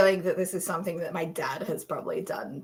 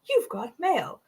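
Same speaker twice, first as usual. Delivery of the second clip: lightly voiced, higher computer greeting; AOL sign-on voice; 6 semitones higher, 3 dB quieter than usual. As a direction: imitate a chirpy automated announcement. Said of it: "the A O L sign-on voice would go".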